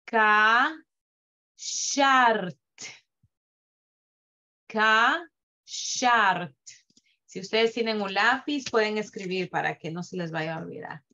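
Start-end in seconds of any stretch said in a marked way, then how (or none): 0.0-6.6 "Ka" then "shart" said apart, final shva silent; split syllables. Shown as a demonstration. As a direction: read "Ka, shart" in two beats. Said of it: "Vamos a ver cómo se lee esto".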